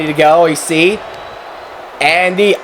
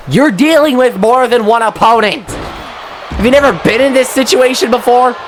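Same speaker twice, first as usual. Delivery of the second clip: exasperated yelling; 5 semitones higher than usual. Real, not acted. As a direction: raise the pitch and yell at her in disbelief. Yelling at the losing wrestler.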